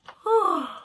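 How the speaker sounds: loud high yawn; big yawn. Acted. Sleepy prince yawning loudly after the long night. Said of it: "before yawning".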